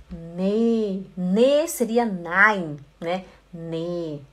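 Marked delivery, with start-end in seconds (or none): none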